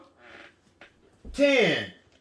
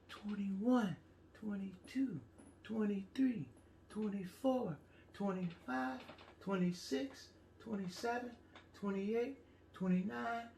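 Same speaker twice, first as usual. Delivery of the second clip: very soft, hushed counting; really soft; 3 semitones lower, 18 dB quieter than usual. Shown as a demonstration. Teacher leading children's count, really soft. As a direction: count as softly as you can. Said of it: "Really soft".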